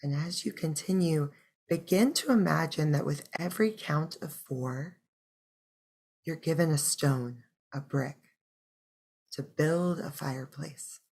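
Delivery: soft meditation voice with long pauses; unhurried, hushed breathing-practice guidance; guided meditation. Acted. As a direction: guide listeners gently into the breath, leaving space between phrases.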